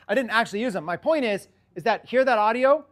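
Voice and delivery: usual speaking voice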